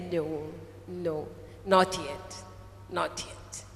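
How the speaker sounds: low, slow, hushed refusal; gentle master. Retold or imitated. The master refusing the cup's plea, low and hushed. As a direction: drop low and soft, fading towards a whisper. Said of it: "the master just, he said".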